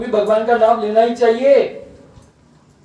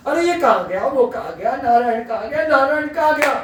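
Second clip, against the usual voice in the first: higher-pitched, mildly fretful searching; fussing doting parent; 8 semitones higher, faster than usual. Retold or imitated. Doting parents hunting for their little son Narayan, fussing lightly. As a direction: imitate a parent fussing after a child.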